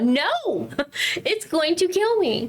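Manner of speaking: high-pitched comic panic; retold scared protest. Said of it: "and I was scared".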